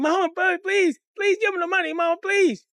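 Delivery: put-on high, wailing child's plea; begging. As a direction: beg Mama like a desperate kid. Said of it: "We're begging".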